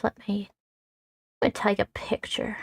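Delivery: unvoiced whisper to self. Real, not acted. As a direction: breathe it out quietly. Quiet aside while working.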